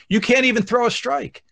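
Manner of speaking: high-pitched mock heckle; heckling. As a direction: taunt like a heckler from behind the plate. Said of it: "I start heckling them".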